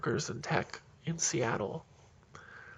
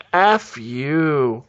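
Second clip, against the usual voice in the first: loud, fed-up outburst; mock shout; 10 dB louder than usual. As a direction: spit it out at them. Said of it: "throw up their hands and go".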